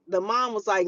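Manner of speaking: ordinary talk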